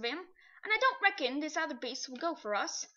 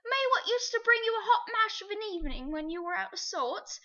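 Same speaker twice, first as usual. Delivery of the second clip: high, loud, wounded pleading; a man begging his old friend to remember; hurt cabby; 6 semitones higher, 4 dB louder than usual. A narrator's reading. The cabby pleading with his horse, high and hurt.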